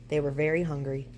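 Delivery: ordinary delivery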